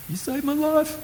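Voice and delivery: put-on a kid welling up in the hallway; mock crying kid. A crying kid thanking him in the hall, high and soft. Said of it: "he'd just start crying".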